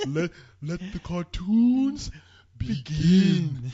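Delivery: villain announcer voice. Retold or imitated. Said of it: "he's like".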